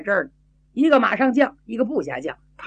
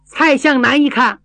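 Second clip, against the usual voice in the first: plain reading voice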